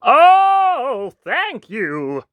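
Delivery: high, long swooping "oh"; grateful cry. Acted. Prince thanking the wolf for the paper feather, crying out. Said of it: "the prince cried".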